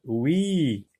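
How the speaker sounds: ordinary delivery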